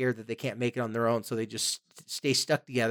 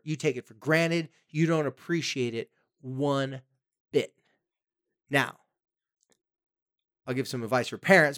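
usual speaking voice

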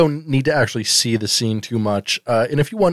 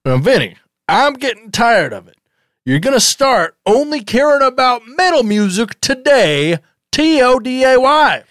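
higher-pitched scolding father parody; mock angry dad; 9 semitones higher than usual. Father ordering Vinny to become a metal drummer, in a higher mock-angry voice. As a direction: lecture him like a fed-up sitcom dad.

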